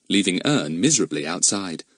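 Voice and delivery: ordinary delivery, nothing marked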